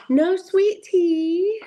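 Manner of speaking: can't believe sweet tea is missing; stretched-out high no sweet tea; surprised. Real, not acted. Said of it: "surprised at missing card".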